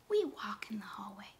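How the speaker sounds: whispered, no voice. Shown as a demonstration. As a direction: whisper it like in a quiet hallway. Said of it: "repeat the sentence just like I said it".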